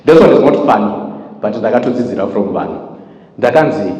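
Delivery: ordinary talk, nothing marked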